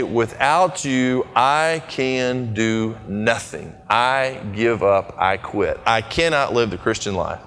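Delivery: low, slow, soft prayer of giving up; hushed slow prayer of surrender; quiet surrendering prayer. Pretend. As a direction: pray it slowly and quietly, letting go. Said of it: "just start and say".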